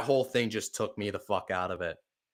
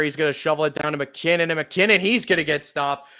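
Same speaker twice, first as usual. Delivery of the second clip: louder, higher play-by-play call building with the run; live play-by-play; 3 semitones higher, 5 dB louder than usual. Acted. Fan narrating the Chiefs drive for viewers, energetic play-by-play. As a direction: call the drive like a broadcaster, picking up pace at the end.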